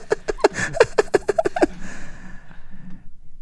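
long staccato mock giggle; imitated backing track. Sitcom laugh track plays on, high and fading. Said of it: "The backing track".

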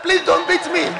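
high, loud mock-panicked plea; retold frightened plea. Pastor pleading with man threatening to beat him, mock-frightened. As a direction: beg in a hammed-up fright. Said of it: "I was immediately afraid".

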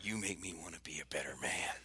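breathy mock-tender whisper of a film line; whispered movie line. Teasing his co-host with a whispered movie quote. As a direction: whisper it like a swooning movie hero.

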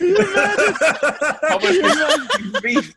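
high, drawn-out laughing squeal on beef; stretched squeal. Acted.

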